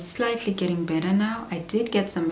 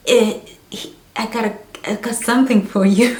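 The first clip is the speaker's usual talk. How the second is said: loud, fast, higher excited gabble; excited blurt; 5 semitones higher, 9 dB louder, faster than usual. Retold or imitated. Running in to tell her husband the news, excited rapid blurt. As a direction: blurt it out in a rush, giddy.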